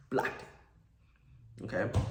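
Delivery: whispered aside; hushed, smug little whisper. Real, not acted. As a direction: breathe it like a secret win. Triumphant aside after blocking her.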